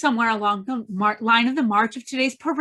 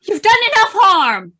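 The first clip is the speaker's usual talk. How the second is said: much higher and louder, urgent; alarmed shout; 8 semitones higher, 11 dB louder, slower than usual. Acted. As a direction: scream at him to stop. Woman shouts to stop him.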